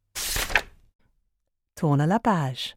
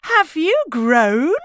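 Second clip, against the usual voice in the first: high, loud playful question; friendly doctor; 9 semitones higher, 5 dB louder than usual. A narrator's reading. Doctor asking Splat, friendly. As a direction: tease a young patient kindly. Doctor chats during exam.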